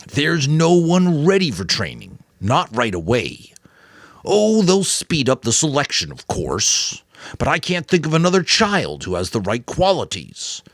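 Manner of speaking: the Giver, earnest elder. A narrator's reading. The Giver explaining to Jonas why he must stay, earnest and urgent. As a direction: press the boy to understand the stakes.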